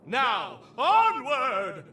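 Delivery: loud, high cry of onward; a rousing shout to move out; rallying call. Acted. Scientist urging the hunter onward, loud rallying call.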